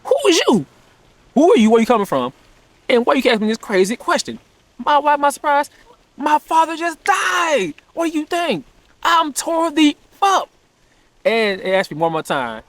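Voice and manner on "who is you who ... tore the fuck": much higher, slowing down, exasperated disbelief; voicing his own stunned reaction to the voice; incredulous outburst. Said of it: "And so I'm like".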